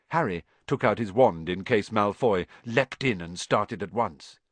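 usual speaking voice

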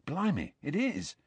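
softly, with hushed surprise; barely above a breath of awe; soft and hushed, taken aback; quietly. A narrator's reading. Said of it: "said Ron quietly".

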